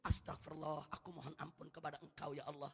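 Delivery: humble prayer. Shown as a demonstration. Preacher models a sinner confessing before God, hushed and humble. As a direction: confess quietly as if praying alone.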